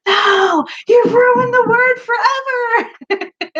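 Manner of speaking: high, loud drawn-out mock wail; mock teen outrage. Imitating daughter's outraged teen friends.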